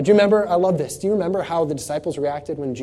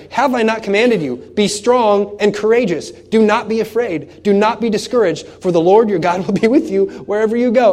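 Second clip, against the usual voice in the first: commanding preached voice; 6 semitones higher, 7 dB louder than usual. Acted. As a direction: thunder God's command to be strong. God commanding Joshua, preached.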